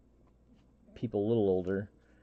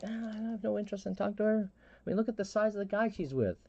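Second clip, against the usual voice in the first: higher, lightly put-on dismissive voice; mock reluctant reply; 11 semitones higher, 4 dB quieter than usual. Answering his friend, a hint of a mock reluctant whine. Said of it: "I'm like".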